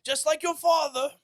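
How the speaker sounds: mock angry British parent. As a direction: play an outraged British mother, way over the top.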